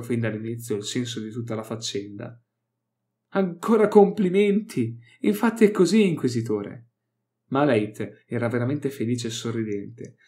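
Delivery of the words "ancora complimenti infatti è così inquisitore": gleeful mock praise. Malait crows his approval at the Inquisitor. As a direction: crow with delight.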